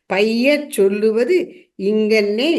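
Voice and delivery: slow, measured verse recital; slow and clear. Shown as a demonstration. Teacher showing how great matters should be told, slow. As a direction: say each word slowly and deliberately. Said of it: "தெளிவா நிதானமா மெதுவா சொல்லணும்".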